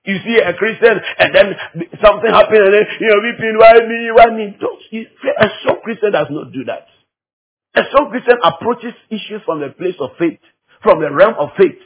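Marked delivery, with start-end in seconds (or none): none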